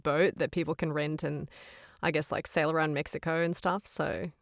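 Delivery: casual Australian accent demo. Shown as a demonstration. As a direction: chat easily in a put-on Australian accent. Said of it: "we can do the Australian".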